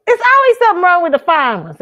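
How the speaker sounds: high, loud cry of complaint; big loud lament from a high start; exasperated shout. Real, not acted. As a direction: holler it in comic exasperation. Exclaimed lament after laughing.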